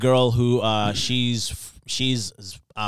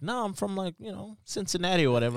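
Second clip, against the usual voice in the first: softer mock American claim; pretend American; 7 semitones higher, 7 dB quieter, faster than usual. The Zim girl pretending to be from Cincinnati. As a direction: fake an American accent, quietly. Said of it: "she tried to pretend".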